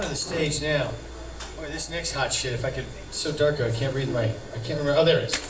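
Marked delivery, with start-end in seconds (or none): none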